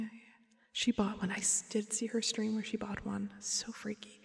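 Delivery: ordinary talk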